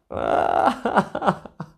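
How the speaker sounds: a high, airy burst of laughter; breathy high laugh. Real, not acted. A high laugh while telling his girlfriend's care. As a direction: laugh it off after admitting tears. Laugh after crying story.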